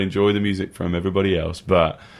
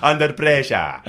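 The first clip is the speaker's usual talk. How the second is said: hammed-up Spanish accent; mock Spanish accent; 6 semitones higher, slower than usual. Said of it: "that's my best Spanish accent".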